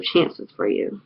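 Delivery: whispered fade. Acted. Reciting lyrics to close the song, whispered. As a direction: breathe the last words out in a whisper.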